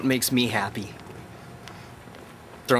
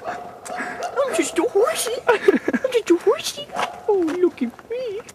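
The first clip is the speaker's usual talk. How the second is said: squeaky cartoon horse voice, much higher and slow; silly high-pitched horsey voice, drawn out; mocking the horse; 5 dB louder, slower than usual. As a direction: ham up an angry little horsey. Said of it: "Look at me, I'm so pissed off".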